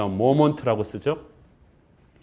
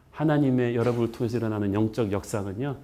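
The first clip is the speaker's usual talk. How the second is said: ordinary talk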